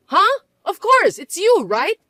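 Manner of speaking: jolt, then grasp for reassurance; startled disbelief. Acted. Mother shocked to hear who owns the house, startled.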